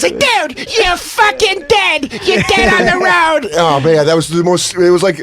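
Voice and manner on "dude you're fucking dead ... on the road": high, drawn-out comic threat; mock furious threat. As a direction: imitate an outraged friend, pitched way up. Acting out Bobby's threat.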